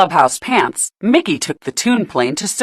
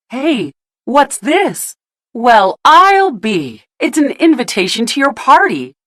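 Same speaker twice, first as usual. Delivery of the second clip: surprised; 6 semitones higher than usual. A narrator's reading. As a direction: marvel at what you found. Pete finds the invitation.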